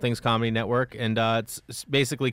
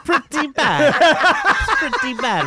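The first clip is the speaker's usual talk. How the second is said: laughing; 13 semitones higher, 6 dB louder, slower than usual. Real, not acted. Mocking her accent, laughing.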